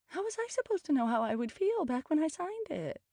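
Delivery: very high, whiny protest; high-pitched indignant whine; voice scaling up. A narrator's reading. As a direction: wail the excuse way up high. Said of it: "her voice scaling up".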